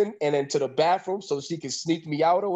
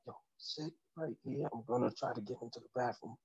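barely audible whisper; very soft, secretive whispered instructions; acted secret whisper; 4 semitones lower, 15 dB quieter, faster than usual. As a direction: imitate her whispering the plan. Woman secretly instructs hidden lover.

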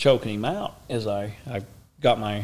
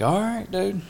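resigned exclamation; 10 semitones higher, 3 dB louder than usual. Retold or imitated. Giving up, about to act.

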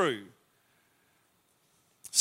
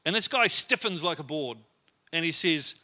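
ordinary delivery

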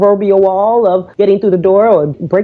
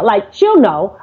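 ordinary talk

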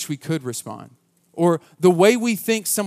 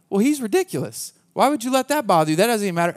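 higher, brushing it off as no big deal; dismissive; 5 semitones higher, faster than usual. Retold or imitated. Youth pastor dismissing a crying student, impatient and higher. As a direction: wave away her hurt like it's nothing.

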